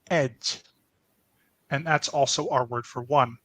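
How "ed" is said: "ed" said slowly and clearly as a Hungarian demo; Hungarian pronunciation. Shown as a demonstration. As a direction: sound out "ed" like a teacher. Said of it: "which is pronounced".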